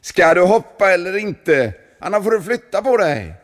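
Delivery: high, loud pushy shout; impatient call. A narrator's reading. A stranger behind the monk shouting impatiently. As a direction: hurry him along. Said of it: "någon ropade bakom honom".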